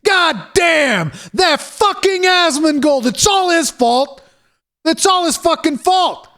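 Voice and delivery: high, slow shouting blame; mock outraged shout. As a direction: yell at Asmongold like a sore dev. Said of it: "what you have to say after that".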